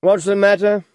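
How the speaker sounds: words round a bite of food; mouth full. A narrator's reading. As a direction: talk through a mouthful. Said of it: "with his mouth full".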